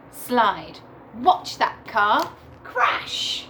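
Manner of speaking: plain reading voice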